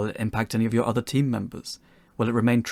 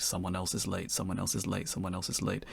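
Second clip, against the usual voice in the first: softer, each repeat dropping away like an echo; 3 semitones lower, 9 dB quieter than usual. Acted. Narrator to game devs, the knock-on chain, softly repeated. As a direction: murmur the chain of delays, fading.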